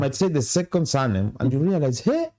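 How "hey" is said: a high-pitched startled "Hey"; shocked realization. Retold or imitated. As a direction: jump up in pitch as the surprise hits. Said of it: "you might be very shocked".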